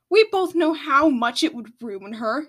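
a loud defiant shout back. A narrator's reading. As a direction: snap it back at the father. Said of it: "Spew shouted back".

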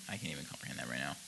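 baffled whispered aside. Real, not acted. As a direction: whisper it to yourself. Baffled aside under breath.

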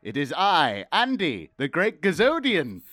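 grand, high alien overlord greeting. Pretend. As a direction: announce yourself like a visiting alien. Podcast host greets Earthlings as alien.

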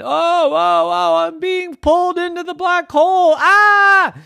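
high, loud panic rising to a scream; panicked cry. Acted. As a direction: cry out as if being sucked away.

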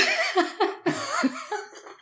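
very high, giddy burst of laughter; overwhelmed laugh. Real, not acted. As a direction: laugh out the emotion. Host overwhelmed by guest's words.

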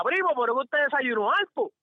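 high teasing question, loud; mocking the other guy. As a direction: needle him with a high mock question. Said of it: "Entonces tú le preguntas". (burla aguda y fuerte)

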